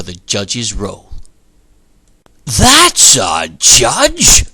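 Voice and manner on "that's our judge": booming, slow triumphant cry; cried out. A narrator's reading. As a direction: proclaim it like a delighted god. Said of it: "the great god cried".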